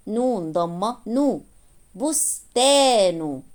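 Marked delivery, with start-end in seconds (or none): 1.9-3.4 بستان slowly, with the middle aa held long; long alif vowel. Shown as a demonstration. Teacher reading the whole word to learners, drawn-out aa in بستان. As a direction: stretch the aa in بستان, short u ending. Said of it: "مد بالألف".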